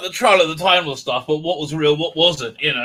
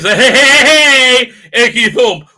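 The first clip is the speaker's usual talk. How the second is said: high, loud, slow laughing mock Doctor; impish Doctor; 6 semitones higher, 8 dB louder, slower than usual. Impishly grinning Doctor mocked for the listeners. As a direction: cackle it out impishly. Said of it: "impishly grins".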